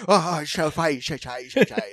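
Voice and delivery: garbled mock Glaswegian; Glaswegian accent. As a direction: imitate an actor's put-on Glaswegian accent. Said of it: "gave him my Glaswegian".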